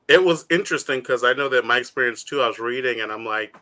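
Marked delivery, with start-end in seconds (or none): none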